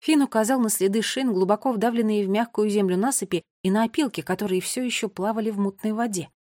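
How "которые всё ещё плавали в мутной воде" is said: ordinary delivery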